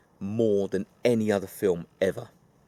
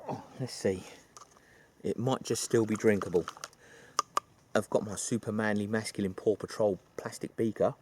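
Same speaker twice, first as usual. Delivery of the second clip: normal talking voice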